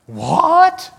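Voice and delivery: very high, loud incredulous what; stunned what. Retold or imitated. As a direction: vets hear he loves marriage, incredulous what. Vets even more shocked.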